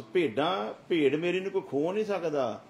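loud, high preaching at full force; fiery preaching. Acted. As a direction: thunder it at the congregation. Preaching about the sheep.

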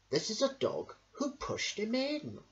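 plain reading voice

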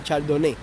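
Chardonnay ending in "né"; TV-series pronunciation. Retold or imitated. Streamer recalling a wine lesson from a series, Chardonnay ending "né". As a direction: say Chardonnay as "char-do-né". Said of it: "lo pronuncié así".

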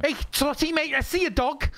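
high mock Scouse voice. Friend calls to Trotty in a high mock Scouse voice. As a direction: call out high like Spiff. Scouse Spiff bit again.

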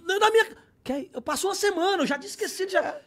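high, loud comic outburst; hammed-up protest, pitched high; exasperated protest. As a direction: burst out in exasperated self-defence. Protesting he had forgotten the call.

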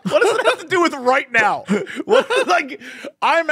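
high, laughing incredulity; laughing disbelief. Real, not acted. Retelling dad's rant, laughing in disbelief. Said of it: "laughing disbelief".

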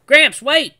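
high, loud urgent call; calling out to Gramps in alarm; shout. Acted. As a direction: yell after him to stop. Calling after grandpa.

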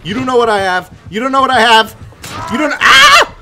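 high, loud yelling that climbs to a scream; frantic taunting. Real, not acted. Player taunting the killer mid-chase, frantic yelling. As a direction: taunt the pursuer at full volume.

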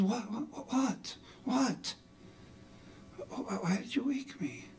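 high, soft, stammering wake-up voice; groggy, high-pitched confusion; startled wife. Retold or imitated. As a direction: imitate someone jolted out of sleep. Wife abruptly woken, retold.

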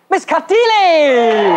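slow, high, drawn-out introduction; grand announcer. Acted. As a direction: stretch the name like an MC.

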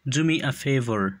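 each word said slowly and distinctly for learners; slow and clear. Shown as a demonstration. Teacher models English phrase for learners, slow and clear. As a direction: model the phrase slowly so students can copy it. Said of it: "به خاطر آموختن تلفظ درستشان".

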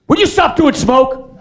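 high loud furious demand; angry yell. Retold or imitated. Actor losing patience with the smoke guy, loud high shout. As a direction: shout it at the crew.

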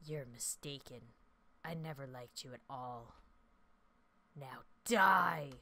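low, hushed ghostly threat building to a snap; a vengeful ghost turning on him; evil ghost voice. Acted. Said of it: "evil ghost voice".

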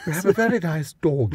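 high, hammed-up posh accent. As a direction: play the lady of the manor, posh. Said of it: "our most posh voice".

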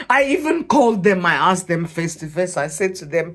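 ordinary delivery

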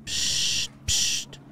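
two firm voiceless hushes; urgent psst sounds; sharp shushing. Real, not acted. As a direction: hush everyone to listen. Hushing at game sound.

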